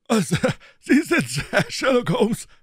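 high, loud delighted roar; a prizefighter roaring in recognition; loud, high boxer's bellow. Acted. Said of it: "brüllte der Preisfechter".

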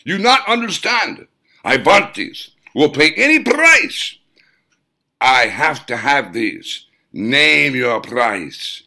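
high loud overbearing gnome-like client. A narrator's reading. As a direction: push your price at him like a pushy gnome. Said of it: "In a overbearing manner".

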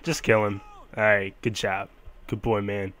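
ordinary talk

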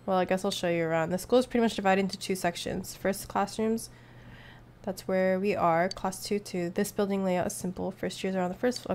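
ordinary talk, nothing marked

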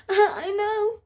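high, mock-crying whine; tearful. Patient to x-ray guy as she starts crying, tearful whine. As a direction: whimper it as if crying. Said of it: "I start crying".

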